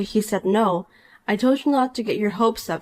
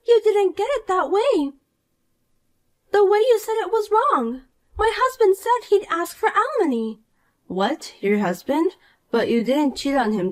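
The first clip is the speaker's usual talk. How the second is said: put-on higher, whiny young woman, hurt; Poppy, upset; 10 semitones higher than usual. Rejected Poppy whines to the wife, higher and wounded. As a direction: complain like a spoiled brat who got rejected.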